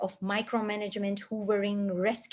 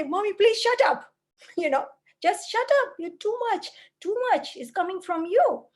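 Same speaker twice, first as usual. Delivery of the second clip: put-on higher, louder exasperated kid voice; fed-up child; 9 semitones higher, 3 dB louder than usual. Child telling lecturing mother to stop, higher exasperated kid voice. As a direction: play the child telling mommy off. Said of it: "the children would just say".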